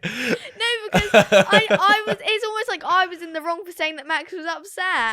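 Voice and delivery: high, laughing protest. Real, not acted.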